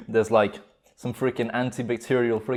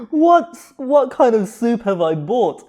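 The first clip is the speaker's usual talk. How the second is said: much higher, slow, half laughing; high disbelieving cry; 10 semitones higher, 6 dB louder, slower than usual. Real, not acted. Shocked by the spicy soup.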